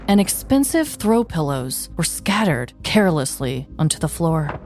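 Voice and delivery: low, steady crime-story narrator; story narration. Acted. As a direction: set a moody scene over the music. Reads crime story over music.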